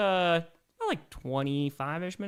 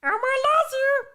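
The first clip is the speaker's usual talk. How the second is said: very high, slow Elmo voice; squeaky high-pitched puppet voice; 5 dB louder, slower than usual. Pretend. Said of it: "Elmo voice?".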